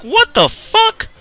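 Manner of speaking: high, stretched-out disbelief; can't believe what he's reading; incredulous outburst. Real, not acted. Fan ranting about a broken card, in high, slow disbelief.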